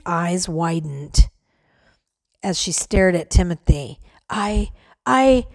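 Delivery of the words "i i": stammering start, then a louder burst; sputtering. Acted. Mary sputtering, caught off guard by Timothy's question. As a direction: trip over the first words. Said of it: "Mary sputtered".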